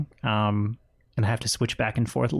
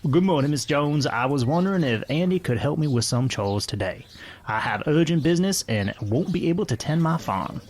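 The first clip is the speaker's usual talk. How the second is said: raised-pitch West Virginia farmer; West Virginia accent; 3 semitones higher than usual. Acted. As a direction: ask a favor in a put-on West Virginia accent. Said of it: "trying to do a West Virginia accent".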